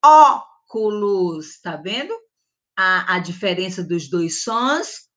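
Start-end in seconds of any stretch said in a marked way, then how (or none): 0.0-1.6 slow "óculos" with a wide open O. Shown as a demonstration.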